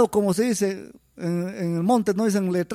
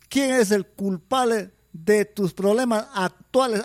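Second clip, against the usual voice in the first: ordinary talk, nothing marked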